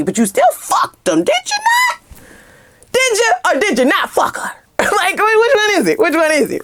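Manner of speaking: high indignant interrogation; confronting a cheater. Pretend. Woman grilling her cheating partner, incredulous and high. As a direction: pin him down, incredulous and high.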